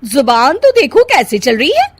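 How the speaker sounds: loud, high, menacing taunt; threatening. Acted. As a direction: threaten her with a sneer. Sister-in-law threatening.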